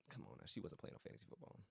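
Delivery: whispered aside. Real, not acted. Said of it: "aside to viewers".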